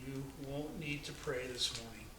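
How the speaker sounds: a soft, hushed reply from mother; said quietly. A narrator's reading. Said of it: "said quietly".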